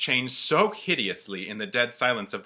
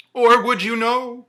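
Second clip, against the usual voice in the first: Marley's ghost; 12 semitones higher, 12 dB louder than usual. Acted. The ghost tells Scrooge of his chain, wailing high and loud. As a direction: moan each word like a chained spirit.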